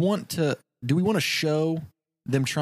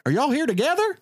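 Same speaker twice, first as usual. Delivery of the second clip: high puzzled teller; 11 semitones higher than usual. Acted. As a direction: ask it high and baffled. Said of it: "the bank teller be like".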